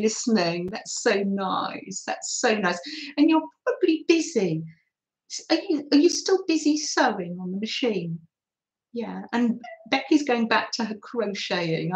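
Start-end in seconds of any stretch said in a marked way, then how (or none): none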